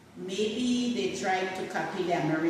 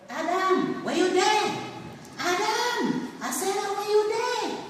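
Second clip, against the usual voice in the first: God calling out; 13 semitones higher than usual. Acted. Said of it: "God came down to the earth and was calling".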